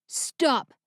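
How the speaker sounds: sharp commanding shout; yelled. Acted. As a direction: halt her with one word. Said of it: "he yelled at the woman".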